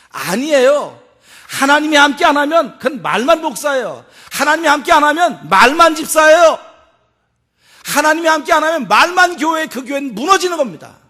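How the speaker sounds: loud, forceful pulpit declaration; preaching at full force. Real, not acted. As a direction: hammer each clause home to the congregation.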